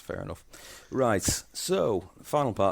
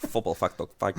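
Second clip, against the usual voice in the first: put-on Dutch accent. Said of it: "say it like a Dutchman".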